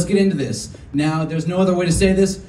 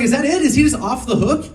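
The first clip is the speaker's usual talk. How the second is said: indignant, higher; 7 semitones higher, 4 dB louder than usual. Retold or imitated. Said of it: "And I'm just like".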